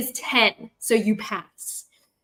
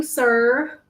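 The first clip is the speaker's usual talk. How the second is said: usual speaking voice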